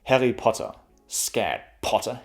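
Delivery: German-accented Harry Potter, "Potter" as "Potta". Shown as a demonstration. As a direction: drop the final R, German style. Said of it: "almost sounds like Malfoy".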